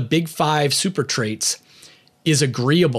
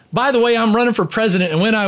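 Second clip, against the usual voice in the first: mock-grand retold declaration, raised and quick; heated boast; 7 semitones higher, faster than usual. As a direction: throw it out like a triumphant threat in a quarrel. Woman declares in heated argument.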